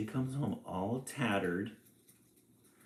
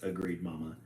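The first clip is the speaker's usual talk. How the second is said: ordinary delivery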